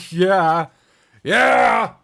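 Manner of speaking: loud yeah rising to a shout; mock cheer. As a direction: build to a high, loud cheer. Cheers after naming ancient walls.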